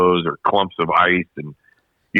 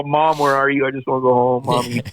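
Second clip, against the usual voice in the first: mock whimpering child, pitched higher; whiny kid for mom; 5 semitones higher than usual. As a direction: play it like a scared little kid calling mommy.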